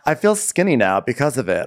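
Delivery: high, bubbly imitated joy; lightly voiced giddy guy; mock gushing. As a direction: gush about it happily. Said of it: "he's like".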